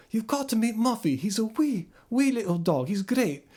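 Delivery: put-on excited Scottish child telling Dad, in Glaswegian; Glaswegian accent. Shown as a demonstration. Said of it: "I'm doing a more Glaswegian accent".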